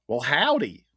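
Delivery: high, loud hammed-up Texas twang. Co-host playing Arcade the international assassin, Texas twang. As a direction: holler it like a cartoon Texan. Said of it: "Texas twang".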